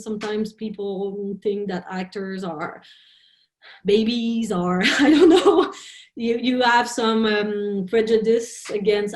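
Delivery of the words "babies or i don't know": breaking into a real laugh. Real, not acted. Laughs at own remark.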